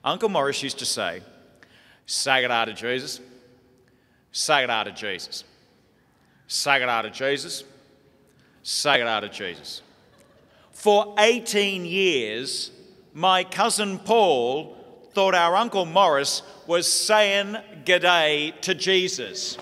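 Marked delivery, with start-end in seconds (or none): 2.0-9.8 an outback rancher leading the rosary in broad Australian; broad Australian accent. Retold or imitated. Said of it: "impersonation of my Uncle Morris's very broad Australian rancher's accent". 10.7-19.6 slow emphatic. Real, not acted. Punchline delivered slowly and loudly.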